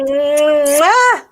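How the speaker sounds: big high, loud "mwah"; exaggerated smacking kiss noise; blown kiss. Real, not acted. Host saying goodbye to her friend, big blown kiss.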